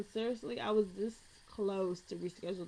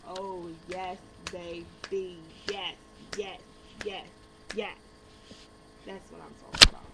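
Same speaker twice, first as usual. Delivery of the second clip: louder, gleeful "yes, yes"; hyped celebration; 2 semitones lower, 5 dB louder than usual. Pretend. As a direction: hype yourself up. Celebrating hair let down.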